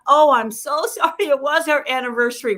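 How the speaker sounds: high, gushing apology with a laugh; mock apology. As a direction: overdo the sorry, high and loud. Acts a partner's apology.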